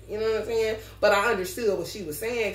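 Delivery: normal talking voice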